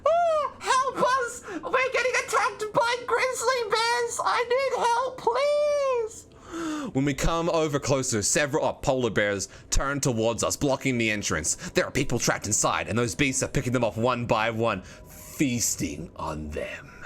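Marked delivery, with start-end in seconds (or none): 0.0-6.3 very high, slow falsetto cries for help; terrified shriek. Acted. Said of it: "We can hear terrified shrieks". 14.8-16.9 low, slow whisper. Acted. Reading grim event text.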